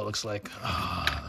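usual speaking voice

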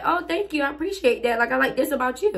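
high, gushing thank-you; reply in a high, sociable voice; friendly thanks. Retold or imitated. Answering a compliment on her hair, high friendly thanks.

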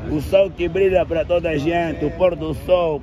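poetic recitation. Acted. Poetic ode to Luanda.

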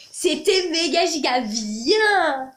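drawn out, slow and emphatic; excited. Real, not acted. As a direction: savour every word with delight. Gushing about the concert.

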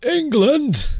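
high, loud shouted England; proud West Country cry of England. Shown as a demonstration. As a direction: belt it out with a Southwest twang. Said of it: "sounds like I'm from the Southwest".

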